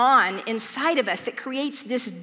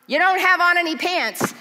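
mock accusing; 6 semitones higher, 5 dB louder than usual.

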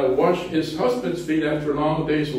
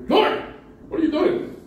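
shocked Peter; 11 semitones higher than usual. Acted. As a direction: play Peter's shock at the Lord. It produced a shock.

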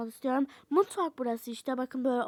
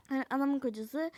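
ordinary delivery

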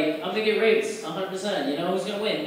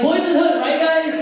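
much higher, loud pleading cry; mock desperate plea; 11 semitones higher, 8 dB louder than usual. Comic pleading with attackers by naming a movie, high loud plea. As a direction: beg the attackers for mercy, hammed up. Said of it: "you can't be like".

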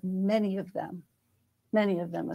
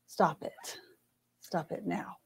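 quiet firm command; 2 semitones lower, 6 dB quieter, slower than usual. Shown as a demonstration. Angel telling Alma to stop, quiet and firm. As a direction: say it quietly with total authority. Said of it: "And not".

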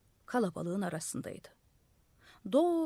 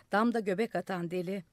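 ordinary delivery

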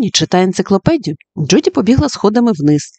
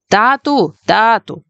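mildly raised call, pitched higher; 4 semitones higher, slower than usual. Acted. Stink calls for his dad across the house, calling. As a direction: call out toward the kitchen. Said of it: "гукав Стінг".

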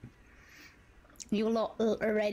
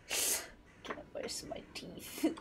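whisper; 5 semitones lower, 6 dB quieter than usual. Real, not acted. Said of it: "I can whisper".

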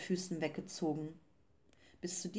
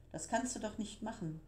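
higher, softer appalled protest; horrified; 4 semitones higher, 5 dB quieter than usual. A narrator's reading. Gigi talking Beppo out of it, horrified. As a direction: protest in hushed dismay. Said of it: "rief Gigi entsetzt".

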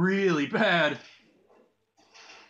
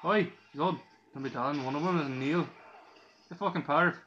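imitation Galway Irish accent; bad Irish accent. Shown as a demonstration. Gamer showing viewers his dad's Galway accent, bad Irish accent. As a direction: put on a hammy Irish brogue. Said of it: "a very bad Irish accent".

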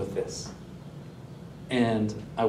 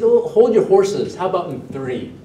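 chiding; 5 semitones higher, 6 dB louder than usual. Retold or imitated. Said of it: "And I said".

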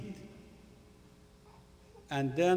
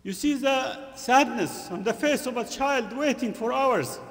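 raised, high-pitched sermon voice; impassioned preaching; 9 semitones higher, 7 dB louder than usual. Real, not acted. Preacher describing a hungry child to the congregation, impassioned preaching. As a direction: preach it loudly and urgently.

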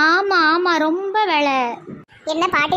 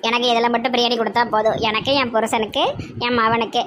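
low, grumbling village woman; low-voiced daughter-in-law; 4 semitones lower than usual.

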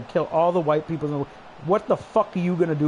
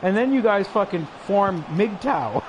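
ordinary talk

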